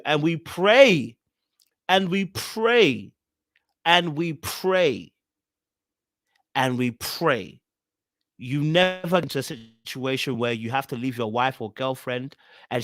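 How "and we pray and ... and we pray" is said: drawn-out refrain. Real, not acted. Host answering viewers who refuse stepkids, slow drawn-out refrain. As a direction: repeat it slowly, letting the last one drop.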